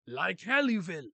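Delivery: loud, drawn-out mock-accent retort; accented shout. Vankov crushing hopes of survival, accented shout. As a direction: shout it slowly in a hammy accent.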